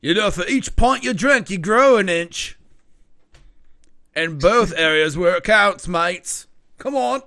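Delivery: loud, high-pitched pub boasting; rowdy drinking mate. Acted. Game master as drinking mate coaxing a player, rowdy and loud. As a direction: egg them on loudly like a drinking buddy.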